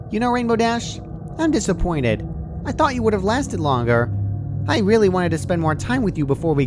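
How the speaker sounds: high, sweet voice with pouty disappointment; a cheery farewell that hides menace; disappointed Pinky. A narrator's reading. Pinky straddling Dash with the scalpel, high pouty sweetness.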